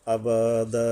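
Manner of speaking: আবাদা chanted with a held stop; stopping recitation. Shown as a demonstration. Teacher shows how to stop on আবাদা, chanted. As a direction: pause on আবাদা with a held last vowel. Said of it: "এক জবর টাইনা থামা যাবে".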